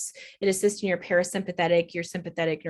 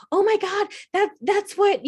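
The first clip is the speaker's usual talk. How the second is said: much higher and louder overjoyed gasp; dramatic elation; 12 semitones higher, 5 dB louder than usual. Retold or imitated. Journaling in her parked car, dramatic overjoyed exclaiming. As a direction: gush like a breakthrough just hit. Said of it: "a little bit on the dramatic side".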